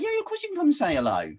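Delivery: high, quick, bright-and-easy yes; voicing her friendly reply. Recalling her answer to the question, high and quick. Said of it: "It's like".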